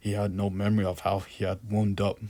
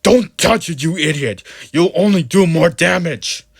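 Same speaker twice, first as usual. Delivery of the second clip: much higher and much louder, a screaming rebuke; furious yell; 9 semitones higher, 14 dB louder than usual. A narrator's reading. Priest yelling at Mister Jones, furious shout. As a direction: scream at him to stop. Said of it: "grabbed the fragment of plaster and yelled".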